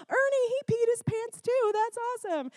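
put-on very high amazed kid voice; excited kids. Kids on the bus seeing the wet boy, very high and thrilled. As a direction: squeal it like a thrilled child. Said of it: "They're like, gasps".

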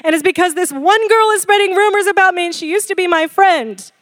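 mock tearful teenage outburst; imitated bawling teen. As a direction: act out an upset daughter venting. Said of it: "she's bawling".

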